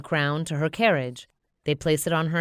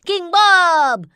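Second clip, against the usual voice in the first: much higher, louder shrugging yell; minion yell; 13 semitones higher, 12 dB louder than usual. Acted. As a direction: yell it to win them back. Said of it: "he shrugs and yells".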